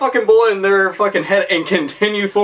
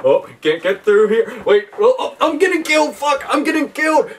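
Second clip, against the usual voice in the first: mock panic; 4 semitones higher than usual. Replaying co-op game panic.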